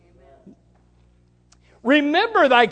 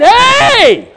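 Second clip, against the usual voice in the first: a loud, high Hey; loud call; 11 semitones higher, 9 dB louder than usual. Pretend. Preacher voicing God calling out to wanderers, loud. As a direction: call out to get attention. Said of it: "God is saying".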